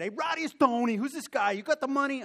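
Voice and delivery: loud, high Italian-American shout; Tony/Italian accent. Retold or imitated. Chicago broker demanding margin money, loud Italian accent. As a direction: bellow it in a caricature Italian accent. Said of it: "Tony was as Italian as you can get".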